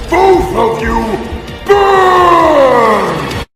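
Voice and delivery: loud, long-held cry sliding downward; villainous shout. Acted. Villain threatening both of them, drawn-out furious shout. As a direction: stretch the word into a roaring threat.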